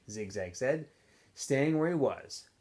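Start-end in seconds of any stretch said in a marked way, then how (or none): none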